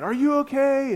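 loud, high call across the cold. Retold or imitated. Pastor calling to an unresponsive driver in his car, loud. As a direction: shout the question to someone who isn't answering.

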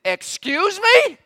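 high, slow, loud offended disbelief; indignant excuse me. Retold or imitated. God affronted at Job, high and slow. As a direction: stretch it out, affronted. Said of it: "It's like God is saying".

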